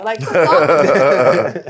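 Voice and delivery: slow, loud, laughing outrage; laughing disbelief. Real, not acted. Mocking pearl-clutching parents, slow and laughing. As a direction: stretch it out through laughter. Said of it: "laughing disbelief".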